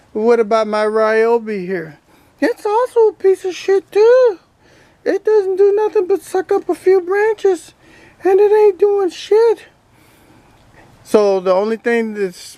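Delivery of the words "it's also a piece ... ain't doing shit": higher, cheeky toy duck voice; duck puppet voice. Acted. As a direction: voice the toy duck answering back. Ducky complains about the Ryobi.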